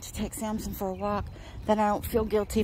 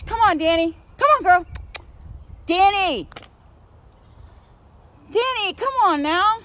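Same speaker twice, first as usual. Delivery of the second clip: high, loud call to a distant dog; 9 semitones higher, 5 dB louder than usual. Real, not acted. Calling dog from afar.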